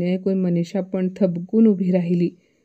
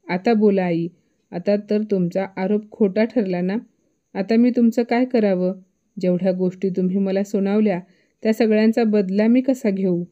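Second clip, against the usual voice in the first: usual speaking voice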